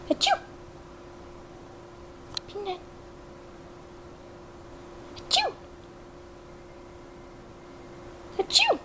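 very high mock sneeze; high playful fake sneeze. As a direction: pretend sneeze to coax the bird. Fake sneezes prompting bird.

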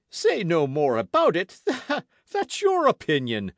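high, loud indignant echo with a laugh; scoffing disbelief. A narrator's reading. Porthos answers Aramis, scoffing disbelief. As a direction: throw it back with a scornful laugh.

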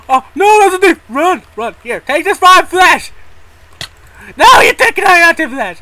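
real panic as a thief mob chases him; panicked shouting. Real, not acted. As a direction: run for your life, screaming.

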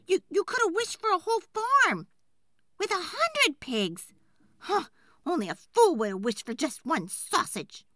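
high, loud, nagging wife berating him; scolding wife. Acted. Wife scolding the woodcutter for wishing for sausage, high loud nag. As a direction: pile on the blame, voice up high.

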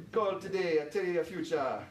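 put-on light West Indian accent, psychic hotline pitch; Miss Cleo West Indian accent. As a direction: hawk fortunes in a mild West Indian accent. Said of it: "my best West Indian accent".

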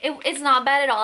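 ordinary talk, nothing marked

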